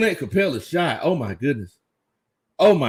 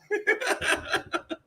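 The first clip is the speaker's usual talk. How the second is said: laugh; 14 semitones higher, 11 dB quieter than usual. Real, not acted. Laughs after teasing coach.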